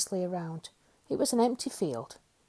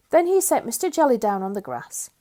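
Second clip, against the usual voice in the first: ordinary delivery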